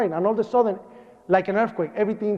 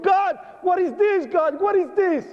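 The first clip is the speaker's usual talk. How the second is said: crying out to God; 12 semitones higher than usual. Retold or imitated. Survivor crying out to God at the bodies, high anguished plea. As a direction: cry out in disbelief at the horror. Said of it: "I started crying".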